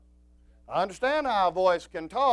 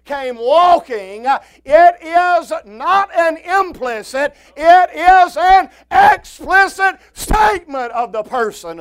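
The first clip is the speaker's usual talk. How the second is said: loud, high-pitched pulpit proclamation; full-force preaching; 12 semitones higher, 17 dB louder than usual. Real, not acted. Preacher insisting the text explicitly means Jesus, full-force preaching. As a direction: preach it forcefully, hammering each phrase.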